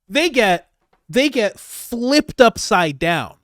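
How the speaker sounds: shouted, drawn-out repeat; loud emphatic repeat. Shown as a demonstration. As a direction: hammer it home with a grin. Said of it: "let me just".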